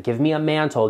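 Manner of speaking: ordinary talk, nothing marked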